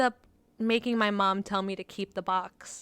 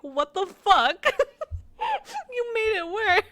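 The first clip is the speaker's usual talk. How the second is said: much higher, near-crying laugh through the words; laughing hard; 12 semitones higher, 5 dB louder, slower than usual. Real, not acted. Telling a friend how grandpa ruined the book, laughing hard. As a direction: squeal it out helpless with laughter.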